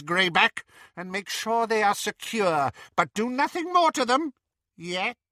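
high, cold menacing witch. Acted. Bellatrix threatening Ron, high cold menace. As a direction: threaten with cruel calm.

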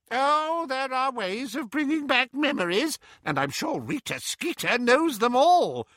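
put-on high, gossipy old woman voice; fussy old aunt. A narrator's reading. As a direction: play a nosy old aunt.

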